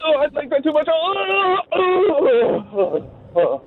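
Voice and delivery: high, fast, breathless moaning; hammed-up orgasm build; mock climax. Acting out climax in role.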